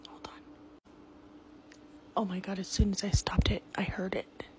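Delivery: hushed and spooked; scared whisper. Real, not acted. Filming alone at night, whispering scared. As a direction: whisper it, frightened, so nothing hears.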